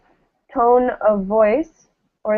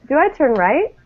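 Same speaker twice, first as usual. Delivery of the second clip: "do I turn right?" lifting up on "right"; rising question; 4 semitones higher than usual. Shown as a demonstration. Teacher prompting Julia with a rising question. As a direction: model the rise for Julia. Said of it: "And Julia".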